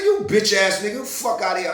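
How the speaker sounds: loud shouted insult; insulting the departing bouncer, shouting; retold shout. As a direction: yell it at his back. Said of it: "I said".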